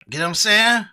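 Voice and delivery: normal talking voice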